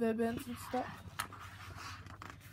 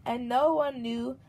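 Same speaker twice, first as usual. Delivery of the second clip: lively, louder storybook read-aloud; reading to a child; 5 dB louder than usual. A narrator's reading. Mother reading a picture book to her baby, lively storybook voice. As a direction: read it out brightly for the little one.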